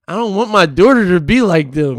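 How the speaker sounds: loud, insistent retold protest; emphatic dismay. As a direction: push it out loudly, as a dawning worry. Acting out his alarmed realisation.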